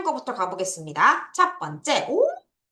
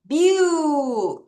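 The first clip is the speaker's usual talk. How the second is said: "view" held long as byuu, falling at the end; syllable drawn out; 3 semitones higher, 3 dB louder than usual. Shown as a demonstration. Teacher repeats the spelling for pupils, long held "view". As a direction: stretch "view" slowly on its own. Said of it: "음절을 잘 끊어야 되죠".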